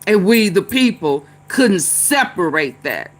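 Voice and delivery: normal talking voice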